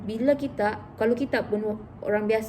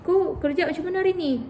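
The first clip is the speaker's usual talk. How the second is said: friendly question; 7 semitones higher, faster than usual. Retold or imitated.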